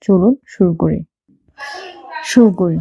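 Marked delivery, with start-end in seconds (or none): none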